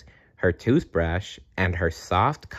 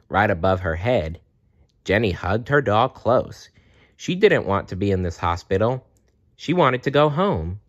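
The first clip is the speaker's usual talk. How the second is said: usual speaking voice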